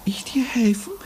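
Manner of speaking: a small unbelieving whisper; timid whisper. Acted. Shy hare to the girl, whispered in disbelief. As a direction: breathe the question out in disbelief.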